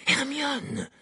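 high-pitched cry of the name; alarmed cry. A narrator's reading. Ron rushes to help Hermione, alarmed. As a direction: call out in alarm, pitch leaping up.

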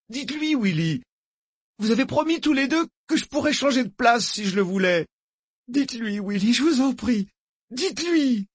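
high, whining desperate plea; imploring. A narrator's reading. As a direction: beg for someone to step in. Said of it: "comme pour l'implorer d'intercéder en sa faveur". (suppliant, geignard, très aigu)

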